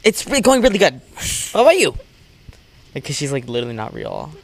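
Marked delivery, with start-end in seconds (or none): 0.0-1.9 high, loud chipper reply; perky high voice. Retold or imitated. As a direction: answer brightly and loudly. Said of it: "Kim was like".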